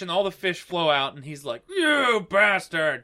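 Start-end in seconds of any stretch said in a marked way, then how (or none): 1.7-3.0 slow, drawn-out mock yell; mock angry yell. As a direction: drag out the insult at the intruder. Angry Sea King woken up.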